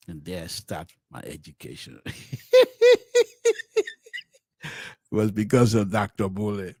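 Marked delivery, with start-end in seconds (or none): 2.1-3.9 high, loud burst of laughter; real laugh. Real, not acted. Host amused by how his education started, laughing hard. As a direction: laugh heartily at your own story.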